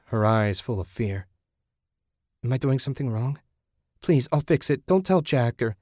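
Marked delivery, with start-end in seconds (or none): 2.4-5.8 rising, panicked plea; frightened pleading. Acted. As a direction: beg him not to tell. Julie fears punishment.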